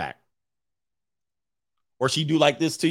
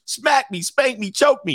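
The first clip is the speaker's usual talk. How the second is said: high-pitched mock frantic urging; hammed-up high excited commands; eager demanding; 10 semitones higher than usual. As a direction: act out her pushy demands, voice pitched up. Acted girl making eager demands.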